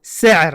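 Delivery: سعر said with a light, sharp s, not sh; سعر with a plain s sound, s-ayr; careful Arabic s in سعر; Arabic sin. Shown as a demonstration. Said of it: "چھوٹی سین سے بولنا ہے".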